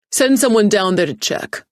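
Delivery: loud, firm demand. A narrator's reading. Teague orders the assistant to check the server, demanding. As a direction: order it so nobody dares question it. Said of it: "he demanded".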